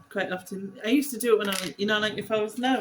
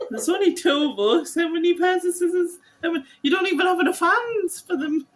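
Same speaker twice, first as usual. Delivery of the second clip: exasperated mock outburst; 11 semitones higher, 8 dB louder than usual. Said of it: "and I'm like".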